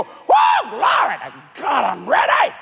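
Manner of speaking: a high whooping Whoa, then glory to God, rejoicing; mock-thrilled believer shouting Whoa; eager giver. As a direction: jump at the chance to give. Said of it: "you go".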